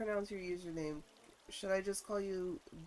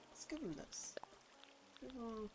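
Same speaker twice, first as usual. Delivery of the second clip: soft unvoiced whisper; 3 semitones higher, 11 dB quieter than usual. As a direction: whisper it like a secret. Sudden secretive decision.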